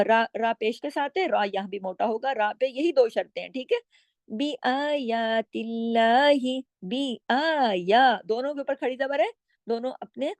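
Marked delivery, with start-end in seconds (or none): 4.3-8.2 "बी आयातिल्लाही" slowly, long aa drawn out; recitation demo. Shown as a demonstration. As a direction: chant "बी आयातिल्लाही" with stretched vowels. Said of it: "अलिफ के बराबर खींच के पढ़ेंगी".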